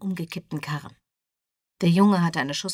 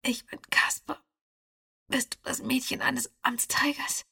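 high, breathless whisper; 7 semitones higher, 4 dB quieter than usual. A narrator's reading. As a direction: whisper it weakly. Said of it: "Er flüsterte".

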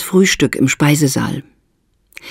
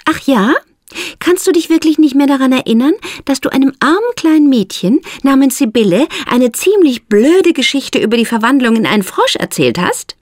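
Esther, indignant; 11 semitones higher than usual. A narrator's reading. Esther confronts Mildred.

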